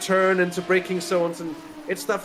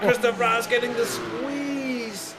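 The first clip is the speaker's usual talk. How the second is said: excited shout; 7 semitones higher, slower than usual. Real, not acted. Commentator calling a car getting squeezed, excited slow shout. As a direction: ride the moment as the cars squeeze.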